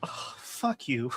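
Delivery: slow, soft, overcome curse; choked-up mock curse. Husband answering wife at the tearjerker, choked up. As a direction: swear softly, drawing it out.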